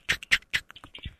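slow whispered click imitation; whispered typing sound.

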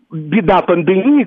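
usual speaking voice

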